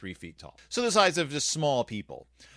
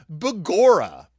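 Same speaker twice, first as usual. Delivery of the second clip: loud Irish leprechaun exclamation; booming stage-Irish greeting; 4 semitones higher, 11 dB louder, slower than usual. Acted. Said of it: "he says, smiling".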